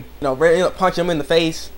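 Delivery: normal talking voice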